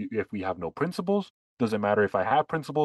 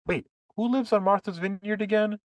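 higher feigned puzzlement, then a flat answer; sarcastic mock wonder; 8 semitones higher than usual. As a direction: play dumb, then drop the punchline. Mock surprised sarcastic question.

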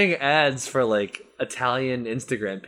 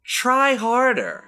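scornful jab; 10 semitones higher, 4 dB louder, much slower than usual. Real, not acted. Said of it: "mocking the decal".

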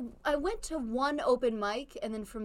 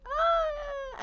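startled squeal. Retold or imitated. Caught off guard by a fan, high startled yelp. Retelling her startled reaction.

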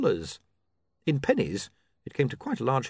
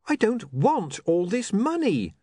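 a higher, slow mock wail; mild wail; 9 semitones higher, 3 dB louder, slower than usual. A narrator's reading. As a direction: stretch the words out in a lightly voiced wail. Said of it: "William wailed".

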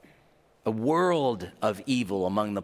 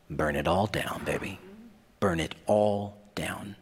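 dramatic preaching; 5 semitones lower than usual. Acted. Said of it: "preacher dramatic repetition".

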